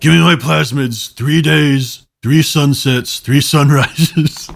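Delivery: slow, drawn-out villain pronouncement; booming echoing villain monologue. Acted. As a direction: intone it like a grand villain. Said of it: "He doesn't sound like this at all".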